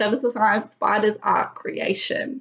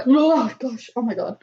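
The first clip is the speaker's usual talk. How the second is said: startled gasp; 3 semitones higher than usual. Real, not acted. Spider fear returns mid reading.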